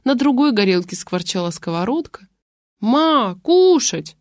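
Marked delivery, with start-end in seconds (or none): 2.8-4.1 put-on high nagging whine of a hungry girl; whining child. Little girl whining to her mother for food. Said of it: "заныла девочка".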